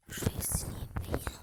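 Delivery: whispered aside; hushed whisper while wiping sweaty hands. Real, not acted. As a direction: breathe it with no voice.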